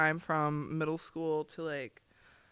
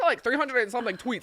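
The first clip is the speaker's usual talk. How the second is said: ordinary talk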